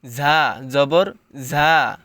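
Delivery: ঝা said firm and hard, slow. Shown as a demonstration. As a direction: hit ঝা with a hard edge. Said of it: "শক্ত করে পড়তে হবে।".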